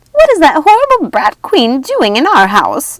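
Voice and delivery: loud, high furious witch yell; enraged witch yelling. A narrator's reading. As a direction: yell in outrage. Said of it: "Martha yelled".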